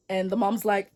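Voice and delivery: ordinary talk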